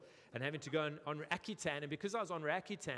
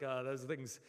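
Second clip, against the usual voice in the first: South African. Retold or imitated. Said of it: "South Africans, we're like".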